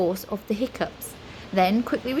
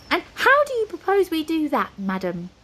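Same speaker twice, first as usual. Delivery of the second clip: haughty high wizard; 8 semitones higher, 4 dB louder than usual. A narrator's reading. Flabbergast haughty.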